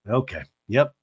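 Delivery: usual speaking voice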